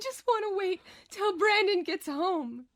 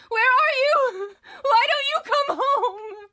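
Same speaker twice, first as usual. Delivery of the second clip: higher, louder, sobbing call; crying out; 7 semitones higher, 8 dB louder than usual. Acted. Celeste calling for Brandon through sobs. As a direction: cry out for him in desperation.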